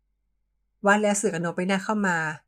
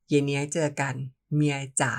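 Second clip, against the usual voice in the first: usual speaking voice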